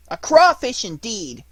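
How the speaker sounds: high, loud, indignant puppet voice; mortified little puppet snapping back; angry puppet. Acted. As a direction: protest hotly as an insulted puppet. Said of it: "said in an angry voice".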